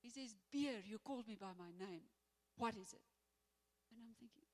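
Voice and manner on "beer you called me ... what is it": loud angry bark, much louder; furious demand. Retold or imitated. Furious guard confronting Pierre, loud angry demand. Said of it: "He's furious".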